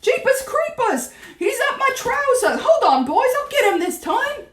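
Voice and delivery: high-pitched, frantic cook's yell; shouting. A narrator's reading. Cook yells that the mouse is up his trouser, shouting. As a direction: holler in a high, loud panic. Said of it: "shouted the cook".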